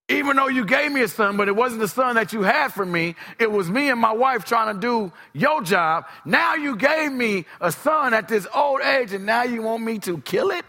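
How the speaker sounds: high, loud pleading cry to God; anguished outcry. Acted. Abraham crying out to God, loud and high.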